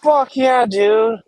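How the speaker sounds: high, loud stoked cheer; excited. Real, not acted. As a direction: whoop with real excitement. Reacting to a big hit.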